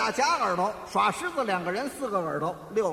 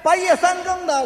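loud, high protest; indignant; 7 semitones higher, 7 dB louder than usual. Acted. Host objects to waiting outside at midnight, loudly indignant. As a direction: object in mock exasperation.

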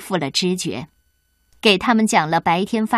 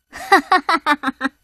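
high, wicked triumphant laugh; evil cackle; 5 semitones higher than usual. Acted. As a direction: cackle like a gloating witch. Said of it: "王后目光凶狠". (邪恶得意的狂笑)

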